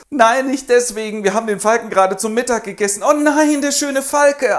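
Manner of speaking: high, loud hammed-up lament; mock sobbing. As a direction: wail over the lost falcon. Breaks into tears.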